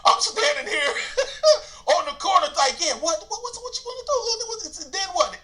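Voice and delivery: high, giggling mock-tough challenge; retelling the standoff, laughing at himself; laughing taunt. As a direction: laugh through the dare.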